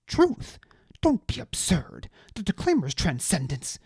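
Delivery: high, loud, outraged aristocrat ranting; indignant high-voiced noble. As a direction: spit out the betrayal in a high indignant voice. Deliritus voice.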